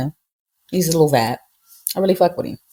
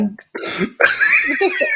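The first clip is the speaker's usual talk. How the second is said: laughing disbelief; much slower than usual. Real, not acted. Disbelief at child's answer.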